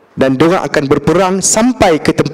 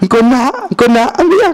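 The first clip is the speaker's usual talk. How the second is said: teasing laugh; 8 semitones higher than usual. Retold or imitated. Prophet teasing the Bedouin.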